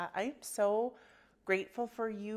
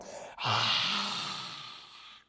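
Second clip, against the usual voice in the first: long, forceful breathy sigh; big released exhale; 3 dB louder than usual. Shown as a demonstration. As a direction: let all the air rush out. Demonstrates untamed exhale.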